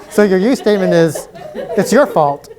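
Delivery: teacher reads the answers back through real laughter; amused, barely holding in a laugh; laughing while reading back. Real, not acted. Teacher reading back a student's funny answer, laughing.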